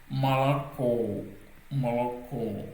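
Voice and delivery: malako drawn out on the final "ko"; slow malako, leaning on its last syllable; stressed last syllable. Shown as a demonstration. Teacher showing learners final stress, malako held on "ko". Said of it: "sur la dernière syllabe".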